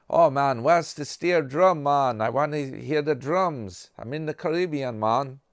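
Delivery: high, loud, lilting fake Jamaican; bouncy, hammed-up Caribbean accent; mock Jamaican accent. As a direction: play an eager tourist faking a Jamaican accent. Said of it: "steel drum one from Jamaica".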